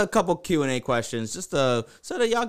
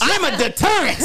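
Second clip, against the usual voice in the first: laughing through the words; self-mocking giggle; 13 semitones higher, 5 dB louder than usual. Real, not acted.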